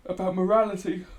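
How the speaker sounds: spoken through a yawn. Real, not acted. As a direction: stretch the words out mid-yawn. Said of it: "Sorry, I'm yawning again".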